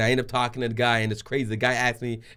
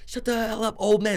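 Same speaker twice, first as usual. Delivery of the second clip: retold angry outburst; mock screaming; 10 semitones higher than usual. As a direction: imitate an irate man yelling, held back in volume. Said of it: "man is just screaming out".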